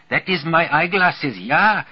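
put-on high, excited German accent. A narrator's reading. Secretary recognizing her eyeglasses, German accent. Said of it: "German accent, all right".

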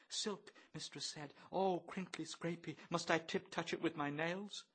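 soft, high timid falsetto; shrinking. A narrator's reading. Bloom shrinks from the silk frock, timid. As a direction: shrink from it daintily. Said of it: "Bloom shrinks".

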